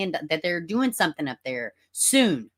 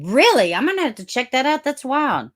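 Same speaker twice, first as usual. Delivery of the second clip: surprised; 10 semitones higher, 6 dB louder than usual. Real, not acted. Reacting to a chat comment.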